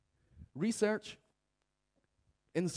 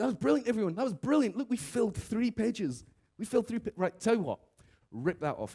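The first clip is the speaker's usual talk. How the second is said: much higher, louder, over-the-top praise; hammed-up delight at three filled pages; gushing facilitator; 9 semitones higher, 4 dB louder than usual. Host wrapping up the session, gushing high and loud. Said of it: "they go".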